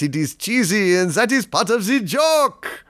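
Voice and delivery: cheesy, lilting mock-French accent; knowingly hammy French accent; outrageous French accent. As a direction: lay the French accent on thick for laughs. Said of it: "my French accent is outrageous".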